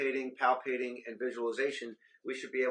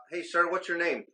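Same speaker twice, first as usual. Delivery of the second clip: loud, raised call to rouse a patient; demonstration shout; 7 semitones higher, 6 dB louder than usual. Shown as a demonstration. Trainer checking a simulated patient responds, demonstration shout. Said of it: "do a tap and shout".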